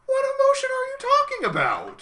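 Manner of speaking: very high-pitched, loud squawk of disbelief; incredulous outburst. Real, not acted. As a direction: blurt it out in comic exasperation. Mock outburst at absurd line.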